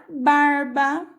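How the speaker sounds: "barba" slowly, stretching the R before "ba"; R puxadinho. Shown as a demonstration. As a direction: draw out the R in "barba". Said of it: "a gente dá uma puxadinha". ("barba" devagar, com o R puxado)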